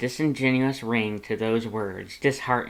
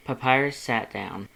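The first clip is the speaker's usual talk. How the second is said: ordinary delivery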